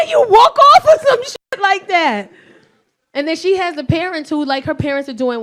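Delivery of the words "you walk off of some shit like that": loud, high, slow outraged yell; half-laughing outraged shout; outraged cry. Real, not acted. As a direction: cry out in disbelief. Outraged at son walking off.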